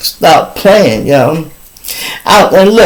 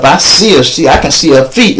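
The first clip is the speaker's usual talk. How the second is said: usual speaking voice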